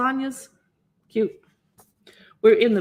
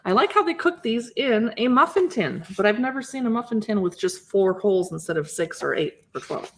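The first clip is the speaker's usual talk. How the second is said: ordinary talk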